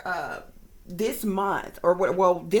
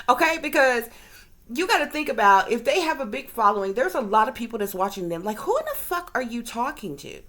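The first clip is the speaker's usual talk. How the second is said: ordinary talk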